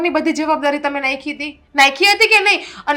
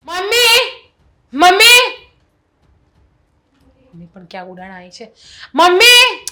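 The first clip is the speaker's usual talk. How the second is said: calling high and loud across the house; daughter-in-law calls her mother-in-law loudly from another room; 3 semitones higher, 4 dB louder than usual. Acted.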